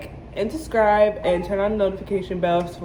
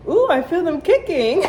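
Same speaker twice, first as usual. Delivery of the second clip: high, louder amazed ooh; delighted surprise, pitched way up; excited ooh; 11 semitones higher, 4 dB louder than usual. Retold or imitated. Mother feeling the babies during surgery, high and amazed.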